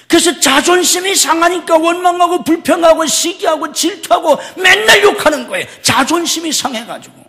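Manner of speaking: high, loud rebuking preach; heated preaching. Acted. Pastor rebuking grumbling believers, loud. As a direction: scold the grumbling in a rising voice.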